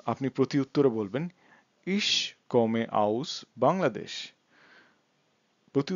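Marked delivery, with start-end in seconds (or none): 1.9-4.1 very slow, word-by-word German; slow language demo. Shown as a demonstration. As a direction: space each word out for learners. Teacher models German answer. (শব্দে শব্দে খুব ধীরে)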